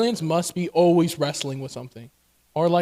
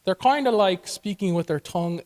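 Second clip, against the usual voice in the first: ordinary talk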